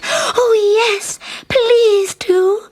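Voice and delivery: high, loud and slow with delight. Acted. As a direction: squeal with joy, drawing it out. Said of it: "clapped her hands in delight".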